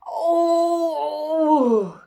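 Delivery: high loud yelp of surprise; startled cry. Acted. Jim sees his quill-filled beard in the mirror, high startled cry. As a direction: jump at the sight.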